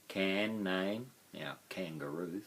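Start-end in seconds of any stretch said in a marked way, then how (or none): none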